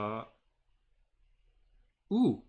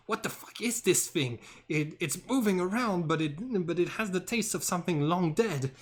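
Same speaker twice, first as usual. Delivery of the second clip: higher, louder, baffled shark shouting; acted yelling; 7 semitones higher, 5 dB louder than usual. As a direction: yell it out like a disgusted shark. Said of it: "yells to Reina saying".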